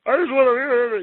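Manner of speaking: high, loud promoter impression with something up in the throat; mock fast-talking promoter; Don King. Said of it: "I wanna try and do the Don King impression".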